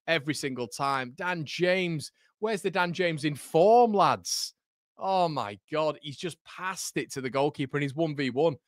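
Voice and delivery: ordinary talk, nothing marked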